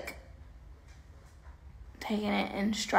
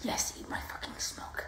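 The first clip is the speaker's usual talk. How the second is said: whispered taunt, no voice at all; 9 dB quieter than usual. Real, not acted. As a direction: breathe it out as a sly whispered dig.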